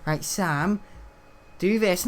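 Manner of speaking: a hint of a bossy teaching assistant; imitating the TA. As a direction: talk down to him like to a child. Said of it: "speaking to me like".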